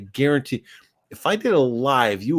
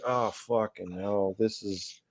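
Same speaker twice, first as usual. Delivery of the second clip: weary mutter; 2 semitones lower, 8 dB quieter, slower than usual. Retold or imitated. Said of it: "I'm like".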